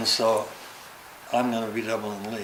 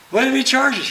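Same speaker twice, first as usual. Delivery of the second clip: higher, pleading voice of a nervous cameraman; mock nervous cameraman, pitched up; fretful cameraman; 12 semitones higher, 7 dB louder than usual. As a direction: whine like a scared crew guy. Cameraman resisting again.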